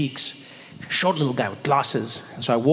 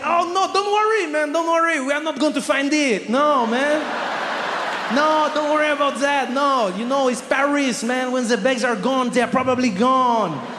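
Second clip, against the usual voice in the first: carefree clerk; 8 semitones higher than usual. Retold or imitated. Paris clerk to the comedian, very high breezy reassurance. Clerk cheerfully says it's lost.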